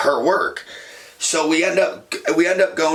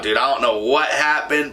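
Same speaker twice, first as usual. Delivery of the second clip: normal talking voice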